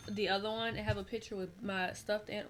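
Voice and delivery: ordinary talk, nothing marked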